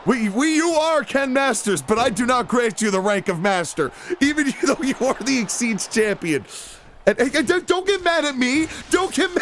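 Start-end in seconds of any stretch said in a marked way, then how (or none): none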